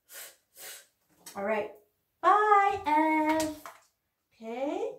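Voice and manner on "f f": "f, f" as a breathy unvoiced hiss with no vowel; letter sound. Shown as a demonstration. Teacher modelling the letter sound for the kids, only air. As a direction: blow "f, f" out as pure air. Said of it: "the first letter we're gonna have out is the f".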